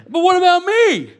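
high, loud mock complaint; mock protest. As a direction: cry out as a hammed-up self-centred objector. Voices a self-centred objection.

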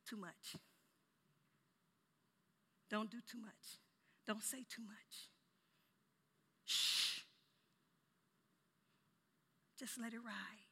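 very soft, spaced-out warnings with a shush; quietly restrain someone, voice kept down; hushed caution. Acted. Loved ones urging you to keep silent, hushed caution. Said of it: "are the ones who say".

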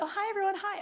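high, sing-song mock greeting; chirpy greeting. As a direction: imitate her bright hello. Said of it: "she was like".

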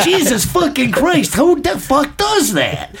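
high, drawn-out mock rant; imitated exasperated yelling. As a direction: stretch out the retold outrage, pitched high. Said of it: "walking down the street yelling at him".

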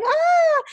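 mock crowd cheer; imitated cheer. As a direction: mimic a whole room cheering. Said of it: "everybody's cheering".

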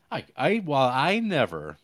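hammed-up huffy offence; scandalized lady. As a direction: play an affronted lady stammering in outrage. Mimics overhearing offended lady.

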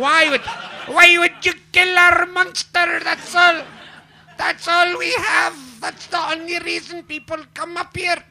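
high, slow, hammed-up Scottish accent; exaggerated Scottish local pleading for his monster; Scottish impression. Scottish local scolding the monster killer, mock Scottish accent. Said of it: "That's an impression of a Scottish person".

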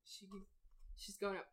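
ordinary delivery

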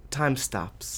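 hushed, ominous drop in voice; dramatic hush. Acted. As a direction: let it fall to a spooky hush. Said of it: "That was so intense".

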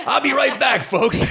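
high, hurried stage announcement; calling to audience. Retold or imitated. Calls out to the audience.

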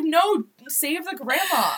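high, slow, pleading cry; pleading Harry voice. Retold or imitated. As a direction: beg to save the grandma, drawn out. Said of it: "He'd be like".

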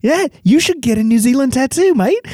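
put-on high, cheerful Kiwi accent; New Zealand accent. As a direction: Kiwi mates egging him on, in a mock New Zealand accent. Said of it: "the Kiwis are like".

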